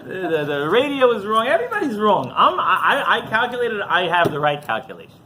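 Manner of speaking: hammed-up insistence, tripping over words; mock self-righteousness. Continues parody of stubborn man.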